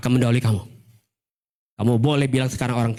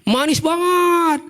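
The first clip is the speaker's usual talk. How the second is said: slow, high, drawn-out manis; very sweet; 13 semitones higher, 4 dB louder, much slower than usual. Shown as a demonstration. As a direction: stretch it sweetly. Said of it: "manis nggak?".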